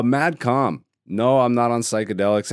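usual speaking voice

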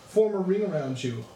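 normal talking voice

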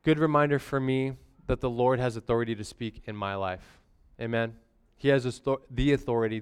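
ordinary talk, nothing marked

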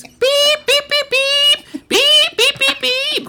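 piercingly high, loud beeping; imitated alarm. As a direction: mimic an electronic alarm at full blast. Imitates the pee alarm going off.

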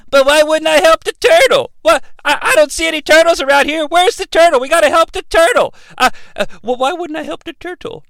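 put-on high, guileless kid voice; high-pitched naive boy. Aang baffled about helping the turtle, high naive voice. Acting out Aang's naive reply.

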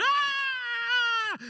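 screaming in excitement; shrill "Ah!" of a roaring crowd; crowd cry. Retold or imitated. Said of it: "people are going".

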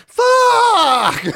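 very high, loud mock shriek; screamed curse. Kirkin panicking as the Citadel falls, shrieked curse.

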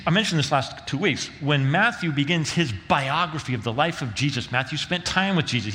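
ordinary talk

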